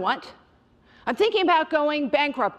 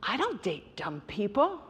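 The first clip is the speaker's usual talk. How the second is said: put-on quieter, cool dismissive boyfriend voice; boyfriend's put-down; 3 semitones lower, 6 dB quieter than usual. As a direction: brush her off as dumb. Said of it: "he goes".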